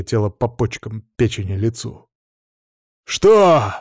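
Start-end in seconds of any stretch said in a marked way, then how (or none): 3.0-3.7 loud, high-pitched roared question; explosive bellowed "what?". A narrator's reading. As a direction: roar it as you whirl on someone. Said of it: "реву".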